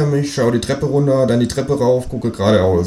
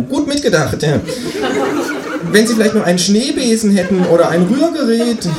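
much higher cheeky kangaroo voice; 13 semitones higher than usual. Acted. Kangaroo asking the narrator for eggs, much higher voice. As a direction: play the new neighbour kangaroo, pitched far up. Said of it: "sagt das Känguru".

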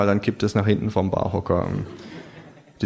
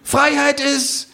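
put-on high, slow, loud drunken musing; drunken kangaroo; 4 dB louder, slower than usual. Drunk kangaroo at the bar, slow and sloppy. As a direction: drawl it out like a drunk philosopher.

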